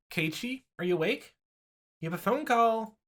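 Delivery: a mom hollering from downstairs; mother calling upstairs. Acted. Mother calls her son to the phone, high and loud. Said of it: "my mother calling me from downstairs".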